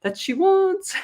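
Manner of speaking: high, excited angel voice. Retold or imitated. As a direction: play the giddy parking angels, hamming it up. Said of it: "'cause they get excited".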